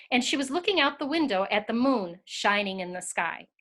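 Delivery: ordinary delivery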